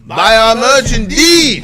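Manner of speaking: high loud booming plug; loud high shout. Pretend.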